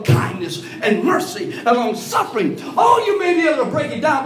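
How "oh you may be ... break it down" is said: preaching shout. Acted. Preacher chiding.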